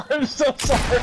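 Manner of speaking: laughing apology. Real, not acted. Apologizing after shooting teammate.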